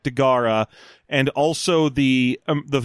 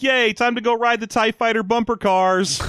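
put-on much higher, eager kid voice; excited kid; 9 semitones higher than usual. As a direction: squeal it like an excited child. Kids excited about the ride.

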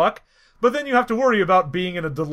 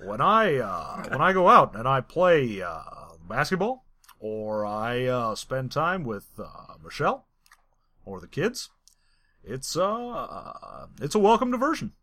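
low, soft, halting presidential impression; Barack Obama; 6 semitones lower, 5 dB quieter than usual. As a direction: drop low and pause between phrases. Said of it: "A M A of Barack Obama".

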